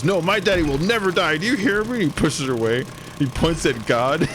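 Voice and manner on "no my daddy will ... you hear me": high, raised mock outburst; mock angry teacher. Mister Noblet snapping at Jerry, hammed-up outburst. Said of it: "And he goes".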